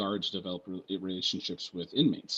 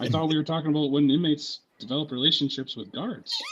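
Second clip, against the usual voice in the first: higher, louder feigned confusion; mock protest; 7 semitones higher, 7 dB louder than usual. Student answering the professor who stopped him, mock-innocent protest. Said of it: "And I was just like".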